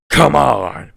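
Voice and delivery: high, loud challenge yell; Senator Armstrong shout. Retold or imitated. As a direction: bellow it like a villain. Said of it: "he goes".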